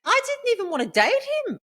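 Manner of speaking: very high, loud indignant protest; real exasperation. Real, not acted. Woman venting to her friend about the awful date, exasperated. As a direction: protest in disbelief, pitch way up.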